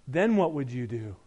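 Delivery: ordinary talk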